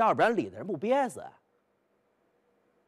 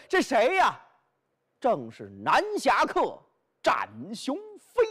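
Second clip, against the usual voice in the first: ordinary delivery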